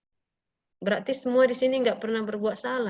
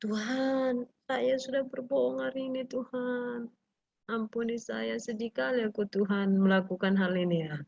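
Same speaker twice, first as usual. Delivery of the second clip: a soft, hushed, sorry prayer to God; teacher modelling a child's hushed confession to God, remorseful; 5 dB quieter than usual. Shown as a demonstration. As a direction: confess it quietly, like a child caught lying. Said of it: "Contoh berbohong".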